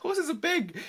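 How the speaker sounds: "Horses are big" repeated high through a laugh. Real, not acted. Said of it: "the lesson of this podcast".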